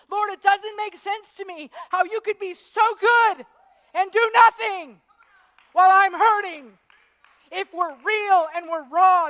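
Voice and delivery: preaching at full force. Acted.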